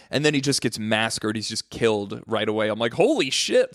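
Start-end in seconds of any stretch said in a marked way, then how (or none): 2.9-3.7 high, stunned exclamation; retold shock. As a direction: leap up in pitch as if caught off guard. Retold shock at kid's death.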